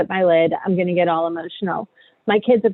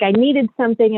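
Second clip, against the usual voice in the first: normal talking voice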